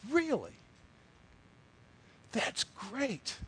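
high-pitched, soft congratulation; acted surprise. Dentist hears Mary is engaged, acted surprise. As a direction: replay a polite, high "oh really" reaction. Reacting to her engagement news.

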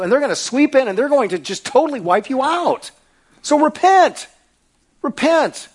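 loud, high, pleading prophet's warning; fiery prophet. Acted. Preacher playing Isaiah warning the nation, fierce and loud. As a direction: cry out repent like an ancient prophet.

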